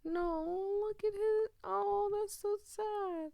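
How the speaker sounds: very high, drawn-out sympathetic wail; pitying whine. Real, not acted. Player reacting to sad game character, high pitying whine. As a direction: squeal with pity, holding the vowels high.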